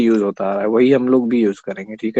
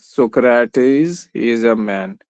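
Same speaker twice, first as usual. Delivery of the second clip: very slow, each word stretched out; write-it-down pace; slow dictation; much slower than usual. Shown as a demonstration. Dictating the second statement. (बहुत धीरे लिखवाना)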